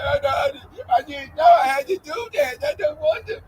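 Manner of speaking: high whimpering fake sob; mock crying. Mocking scared gang members, high fake crying. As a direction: whine like a scared kid about to cry. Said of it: "And they crying and stuff".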